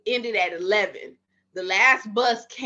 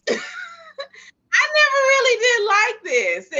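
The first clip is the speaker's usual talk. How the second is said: laughing it out, pitched up high and loud; 3 dB louder than usual. Real, not acted. Laughing while telling the host she hated her job. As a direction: laugh through the admission.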